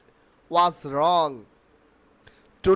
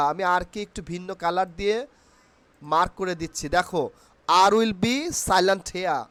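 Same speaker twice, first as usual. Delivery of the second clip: ordinary talk, nothing marked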